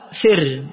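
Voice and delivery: أثر with a second hamza as a glottal stop, then a break; original two-hamza form. Shown as a demonstration. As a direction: say أثر haltingly, stopping hard on the second hamza before the last syllable. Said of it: "أصلها".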